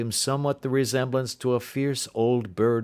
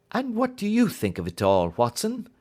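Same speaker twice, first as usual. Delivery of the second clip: a lightly raised, brisk Holmes; 13 semitones higher than usual. A narrator's reading. Holmes asking Watson his view, lightly voiced. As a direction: lift the voice into Holmes, curious.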